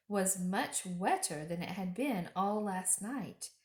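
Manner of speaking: plain reading voice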